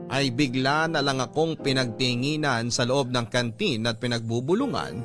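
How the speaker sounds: normal talking voice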